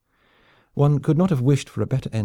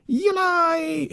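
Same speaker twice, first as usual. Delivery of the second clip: very high shrieking war cry; shrieked battle cry. Acted. Armored knight charges the bridge, very high shriek. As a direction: scream the charge. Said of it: "he shrieked".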